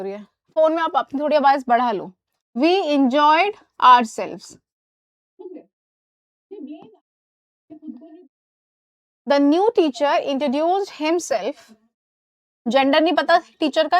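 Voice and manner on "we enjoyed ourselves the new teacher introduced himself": very slow, each word stretched; slow model sentence. Shown as a demonstration. Teacher dictates the correct sentence slowly to students. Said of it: "dictated examples".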